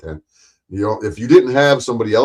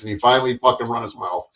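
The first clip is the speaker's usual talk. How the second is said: ordinary talk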